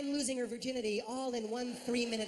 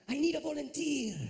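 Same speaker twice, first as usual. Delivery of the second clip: higher, louder suave croon; 6 semitones higher, 4 dB louder than usual. Retold or imitated. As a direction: ham up a smooth pop star. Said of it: "That is not how he sounds".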